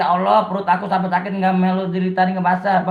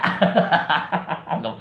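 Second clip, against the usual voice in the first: hearty chuckling laugh; real amused laugh that tails off; 3 semitones lower than usual. Real, not acted. Streamer amused at being teased for cheating, laughing.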